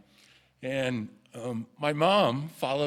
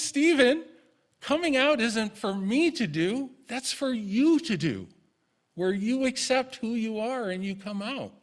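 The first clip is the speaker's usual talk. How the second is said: higher, louder, earnest motherly advice; voicing mom; 10 semitones higher, 4 dB louder than usual. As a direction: lift the voice into mom's firm wisdom. Mother's wise words voiced.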